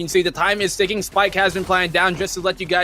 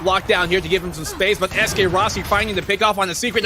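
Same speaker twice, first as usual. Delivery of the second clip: usual speaking voice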